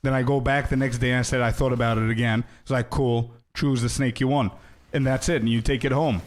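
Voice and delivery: ordinary delivery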